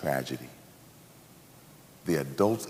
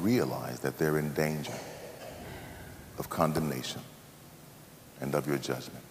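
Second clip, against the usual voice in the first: earnest spoken prayer. Acted. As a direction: pray it with conviction. Leads closing prayer.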